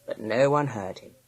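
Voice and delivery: plain reading voice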